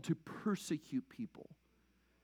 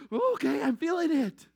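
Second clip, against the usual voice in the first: higher, louder mock "I get it"; mock felt reaction; 9 semitones higher, 6 dB louder than usual. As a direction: play the listener hit by the weight. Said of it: "Like in those verses, like".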